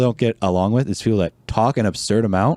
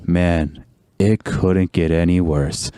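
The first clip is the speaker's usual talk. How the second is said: lower, slow, mock-glum moan; gloomy overshare; 6 semitones lower, much slower than usual. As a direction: drag it out like a sorry sad sack. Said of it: "you don't go".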